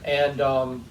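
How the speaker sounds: ordinary talk, nothing marked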